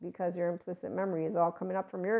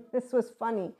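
real laugh running into higher words; giggle, then speak through the amusement; amused laughing; 4 semitones higher than usual. Real, not acted. Speaker recalls a funny experience for listeners, amused laughing. Amused by her own anecdote.

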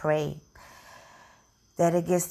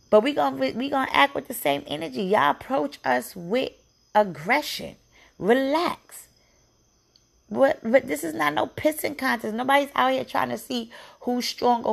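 real anger building up; heated outrage; 6 semitones higher than usual. Real, not acted. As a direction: push back hard at the police. Venting anger at police aggression.

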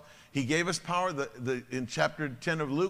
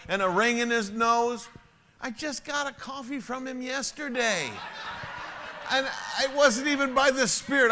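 much higher and louder, laughing through words; 8 semitones higher, 5 dB louder than usual. Real, not acted. Pastor laughs telling the congregation the joke, loud. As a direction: crack up while telling it.

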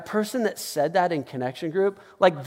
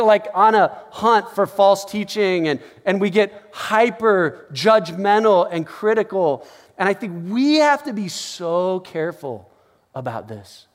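ordinary talk

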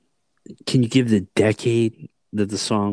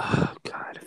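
whisper; 6 dB quieter than usual. Real, not acted. Muttering doubt over hint.

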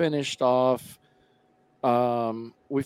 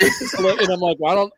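laughing; 6 semitones higher, 5 dB louder than usual. Real, not acted. Laughing while retelling the recording.

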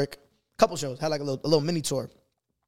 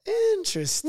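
mock intrigued; slower than usual. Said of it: "I'm like".

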